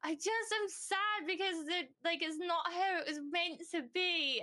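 high, drawn-out mock wail; bursting out. Finally bursting out to dad about feeling sad, wailing. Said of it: "like, burst".

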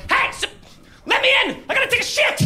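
mock desperate high-pitched yell. Stranded driver begging to merge, mock desperate yell. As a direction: plead to be let in, hammed-up. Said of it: "He was going".

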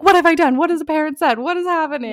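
mock panic of a boss's summons; freaking out. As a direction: replay the rush of dread at being called in, high and breathless. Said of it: "freaking out".

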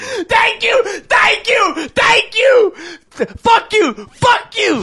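high, loud yelling thank you; triumphant shout. Real, not acted. Vindicated by a friend, yelling thanks then curses. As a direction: scream with relief at being proven right. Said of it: "vindicated by the R, shouting".

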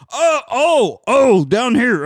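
loud, high hammed-up cry; mocked late pursuer. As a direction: imitate a latecomer calling out below. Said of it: "someone else comes up".

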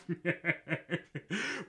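a short rising laugh at his own joke; amused laugh. Real, not acted. Host laughing at his own KFC boycott joke, amused laugh. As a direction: let a real laugh bubble up.

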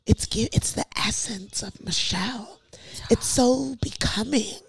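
hushed mock-admiring whisper. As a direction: breathe it out like a gushing secret. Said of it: "they was like".

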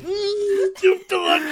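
high, loud mock ad outburst; hammed-up product cry. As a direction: squeal the brand name, straining high. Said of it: "You're like".

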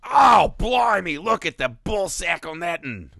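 put-on loud, high Australian accent on "Oh, blimey"; Steve Irwin impression. Host to co-host, mock Australian croc-hunter accent. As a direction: do a hammed-up Australian wildlife host. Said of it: "That was like Steve Irwin".

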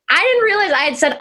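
ordinary delivery

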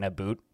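"a boot" with a long oo, for "about"; Canadian "about". Retold or imitated. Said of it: "I'll accidentally slip into".

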